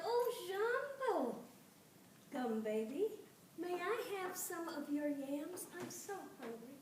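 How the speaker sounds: high, slow cooing fairy; sweet fairy voice. A narrator's reading. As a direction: coo at the doll like a hungry sprite. Fairy greets the gum doll.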